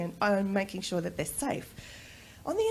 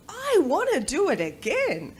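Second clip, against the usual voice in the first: put-on higher and louder eager child; imitating her son; 7 semitones higher, 6 dB louder than usual. Mother voices son wanting more, eager child voice. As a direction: say it like a keen little boy.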